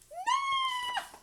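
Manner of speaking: very high piercing no; shrieked no. Acted. Elephant horrified at the birds on his head, shrieking no. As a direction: shriek your refusal.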